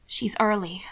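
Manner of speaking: hushed worried whisper. Acted. Margot whispers the worry to Hannibal. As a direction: barely breathe it.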